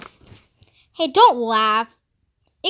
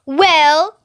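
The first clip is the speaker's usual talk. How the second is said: loud, higher, drawn-out prompt; bossy, expectant burst; glaring impatience; 4 semitones higher, 8 dB louder than usual. A narrator's reading. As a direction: demand an answer impatiently. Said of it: "she glared at him".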